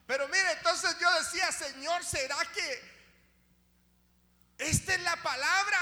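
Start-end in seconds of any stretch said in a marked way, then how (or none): 0.1-3.0 builds from steady talk to high, loud shouting; preaching at full force. Acted. Preacher promising God will help, building to full-force shouting. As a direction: climb until you're crying out the promise.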